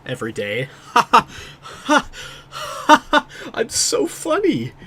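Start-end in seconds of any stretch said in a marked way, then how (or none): 0.7-3.5 high breathy giggle. Real, not acted. Laughing at own joke. 3.5-4.7 high, slow mock-smug brag. Self-praising joke after laughing.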